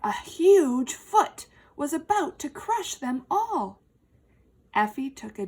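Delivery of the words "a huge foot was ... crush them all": dramatic build. A narrator's reading. Dramatic peril narration.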